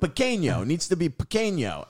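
high-pitched mock uncle searching for Spanish; put-on uncle with clumsy Spanish; uncle's bad Spanish. Uncle telling the crew what to cut, mock bad Spanish. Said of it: "doesn't speak any Spanish".

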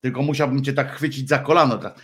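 usual speaking voice

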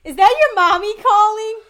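loud, high, drawn-out sassy taunt. Acted. Imitating Jo taunting someone about mommy calling, sassy. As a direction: tease in a mock sassy voice, slow and high. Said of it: "Jo must be feeling real sassy".

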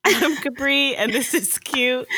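high, slow, loud host intro; bright show intro. Acted. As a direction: announce the show with a grin. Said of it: "show intro".

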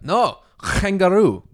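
high, loud, slow guttural mock Middle Eastern accent. Imitating a man insisting he is Australian, guttural mock Middle Eastern accent. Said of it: "Says it like that".

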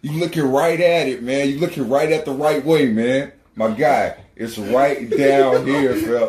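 hyped local helping out a stranger; high-energy directions. Retold or imitated. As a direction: give directions with overflowing enthusiasm. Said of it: "one hundred fifty percent energy behind them".

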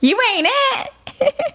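high, very slow, laughing through the words. Real, not acted. Mocking the guy who thinks he's water, laughing. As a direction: break up laughing as you say it.

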